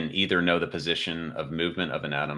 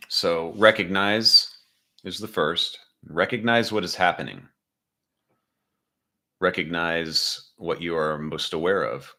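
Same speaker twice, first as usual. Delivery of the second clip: guided meditation. Acted.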